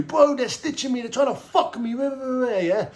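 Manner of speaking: high, sing-song complaint to fellow inmates; animated protest. Retold or imitated. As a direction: wind yourself up telling everyone it wasn't you. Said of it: "I'm animated in prison".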